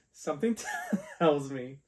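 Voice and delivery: real laughter breaking up the words; laughing. Real, not acted. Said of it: "why I'm laughing right now".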